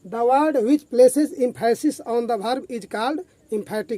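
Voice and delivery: whole definition in one very slow even flow; slow full read. Shown as a demonstration. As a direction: read the full sentence slowly without breaking it up. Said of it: "इसे इस प्रकार define करेंगे".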